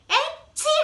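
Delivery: high acted sneeze. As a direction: burst out a sharp sneeze. Dudu sneezes in story.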